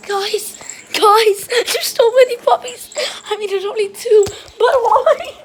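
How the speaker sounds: high, squealy laugh-cry. Real, not acted. Calling to her viewers while puppies swarm her, laugh-crying. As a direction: wail it, half laughing, to viewers.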